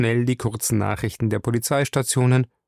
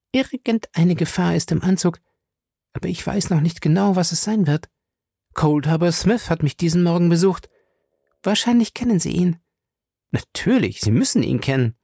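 high-pitched woman giving a warning; Fay's character voice, serious; 7 semitones higher than usual. Acted. Fay tells Featherstone of coming danger, high and earnest. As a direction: play a concerned lady passing on news.

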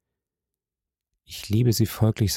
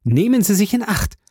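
much higher, fervent warning; urgent joy breaking through; elated, trembling-with-hope plea; enthusiastic warning; 9 semitones higher than usual. A narrator's reading. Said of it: "in höchster Begeisterung".

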